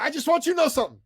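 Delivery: very high put-on voice; insistent guy impression. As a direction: announce it in a high comic character voice. Imitates the guy confiding warning.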